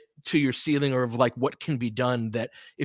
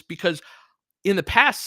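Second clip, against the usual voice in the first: ordinary delivery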